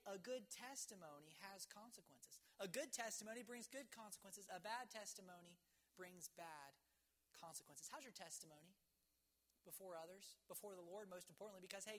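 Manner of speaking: normal talking voice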